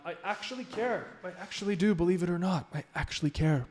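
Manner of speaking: really mean that you care; sincere. Real, not acted. Sincere plea, high and soft.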